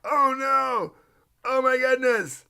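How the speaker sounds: high, wailing fake panic, building to a shout; mock panic. Mocking panicked crypto holders, hammed-up high wail. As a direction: ham up a frantic investor crying over the crash. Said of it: "They're like".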